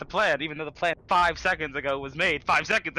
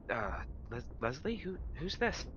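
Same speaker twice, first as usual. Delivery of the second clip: softer, hesitant question; wary villager; 7 dB quieter than usual. Acted. Villager approaching Leslie, quietly asking about the stranger. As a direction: ask it quietly, unsure of the stranger. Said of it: "a person comes over and just like".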